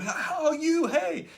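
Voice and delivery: very high, bright laughing hello; cheery greeting. Retold or imitated. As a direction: wave at a stranger with a big grin. Dentist waving at strangers.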